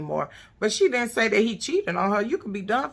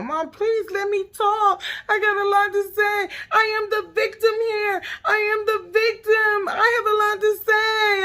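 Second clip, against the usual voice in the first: much higher, louder whiny mock voice; mocking whiny Egypt; 13 semitones higher, 4 dB louder than usual. Mocking Egypt begging her mom to let her talk. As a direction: mimic a bratty victim pleading. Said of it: "She telling her mama".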